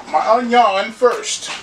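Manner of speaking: put-on slow Cajun accent, onion drawn out as "on-yawn"; Justin Wilson. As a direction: drag out onion like a Cajun TV chef. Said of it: "You gotta say it like Justin Wilson".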